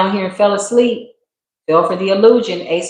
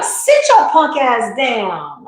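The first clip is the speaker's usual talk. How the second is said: very slow, higher, drawn-out sassy command; sassy higher self; 5 semitones higher, much slower than usual. Retold or imitated. Higher self telling the reader to sit down, very slow and sassy. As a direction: stretch every word like a diva telling you off. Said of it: "She be like".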